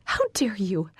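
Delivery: hissed, voiceless rage; choked whispered anger; furious whisper. Acted. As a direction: whisper it through fury. Fury breaking into whisper.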